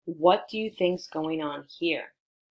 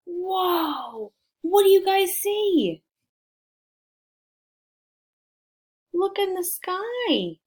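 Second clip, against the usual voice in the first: much higher and louder, wide-eyed wonder; amazed whoa; 13 semitones higher, 4 dB louder, slower than usual. Real, not acted. Reading to children, dinosaurs fill the sky, amazed.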